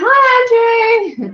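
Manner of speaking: high, loud, held sing-out greeting; chorus greeting. Retold or imitated. Passing on viewers' hello to Andrew, calling out. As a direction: call it out big and long. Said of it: "They're all saying".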